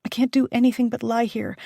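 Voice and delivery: ordinary delivery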